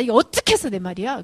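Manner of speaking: loud, indignant challenge; angry older brother. Acted. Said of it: "머리 끝까지 화가 납니다". (화난 형처럼 크게 따지듯)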